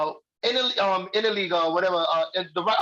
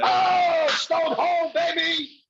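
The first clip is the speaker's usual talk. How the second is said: big high cheer, shouted; excited shout; 8 semitones higher, much slower than usual. Real, not acted. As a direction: yell it out in joy. Celebrating a run scoring.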